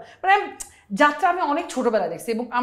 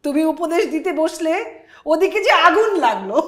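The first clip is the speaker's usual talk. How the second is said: slow, loud, high declaiming stage voice; jatra dialogue; 7 semitones higher, 6 dB louder than usual. Acted. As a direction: proclaim it to a village crowd. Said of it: "তুমি ওভাবে বলো".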